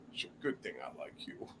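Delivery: hushed, low, quick aside; retold grudging forgiveness. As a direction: drop to a near-whisper, quickly. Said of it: "He was like".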